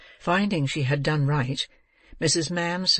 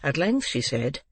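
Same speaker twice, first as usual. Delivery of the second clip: usual speaking voice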